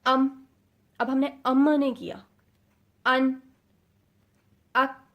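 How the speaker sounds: ام ان اک stopped instantly at the end; ام ان اک with no rebound; no qalqala. Shown as a demonstration. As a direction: stop dead on the final letter. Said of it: "stop, instant ہم نے رک جانا ہے".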